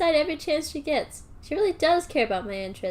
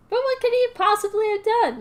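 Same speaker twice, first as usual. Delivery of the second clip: higher, louder indignant demand; 7 semitones higher, 5 dB louder than usual. Acted. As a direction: press him for an answer. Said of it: "Millie demanded".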